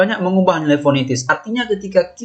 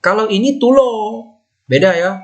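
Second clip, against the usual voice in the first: normal talking voice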